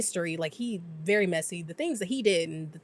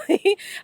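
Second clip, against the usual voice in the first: a sudden high laugh; loud burst of laughter; 12 semitones higher, 7 dB louder than usual. Real, not acted. Laughing while recapping the alien babies scene, a real sudden laugh. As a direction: crack up at the scene.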